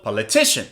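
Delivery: "Politician" with TI stressed; "Politician" peaking on the third syllable. Shown as a demonstration. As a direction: lean on TI in "Politician". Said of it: "read the three of these words".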